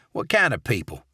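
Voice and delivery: lightly gruff manager; a hint of a grumpy boss. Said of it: "gruff voice".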